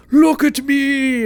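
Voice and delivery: very high, loud, slow cry of a magic hand; mystical hand calling out. Pretend. A hand from nowhere demanding attention, very high and loud. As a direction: call out like a grand, showy spirit hand. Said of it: "Hand comes out of nowhere, goes".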